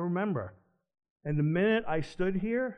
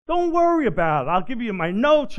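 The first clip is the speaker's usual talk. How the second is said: much higher and louder, breezy and upbeat; cheerful boss brushing off worries; breezy reassurance; 8 semitones higher, 10 dB louder than usual. Retold or imitated. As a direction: toss it off like it's no big deal. Leader assuring him casually.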